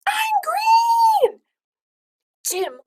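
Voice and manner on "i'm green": very high, loud shriek; yelled. Acted. Boy discovering he turned green: high yell. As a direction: shriek it in shock. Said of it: "Tim yelled".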